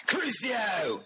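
mock villain yelling a curse; shouted curse. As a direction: cast it with a high shout. Villain casts torture curse.

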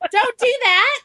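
embarrassed protest. Real, not acted. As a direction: yelp to stop him playing it. Protesting voicemail being played.

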